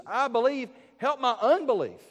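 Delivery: loud, high plea of a distraught father; crying out. Retold or imitated. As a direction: cry it out like a desperate father. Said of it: "who cries out to Jesus".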